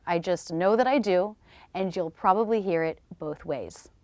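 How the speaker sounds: ordinary talk, nothing marked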